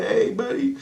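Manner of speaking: put-on high-pitched hello, as to a little kid; playful greeting to child. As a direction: answer a small child in a high voice.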